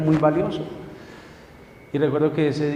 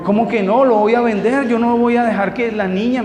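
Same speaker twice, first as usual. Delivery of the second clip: loud, much higher exasperated protest; heated protest; 6 semitones higher, 6 dB louder than usual. Retold or imitated. Husband arguing with wife about selling the watch, heated and loud. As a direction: fight back in disbelief.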